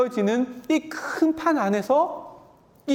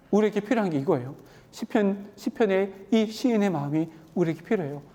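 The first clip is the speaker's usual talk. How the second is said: normal talking voice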